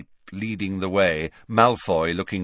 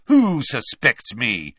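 angry; 3 semitones higher, 5 dB louder, slower than usual. A narrator's reading. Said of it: "said Malfoy angrily".

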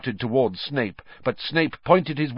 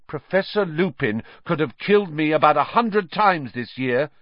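put-on higher-pitched boy's argument; heated protest; 7 semitones higher than usual. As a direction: argue back insistently. Harry argues back at Snape.

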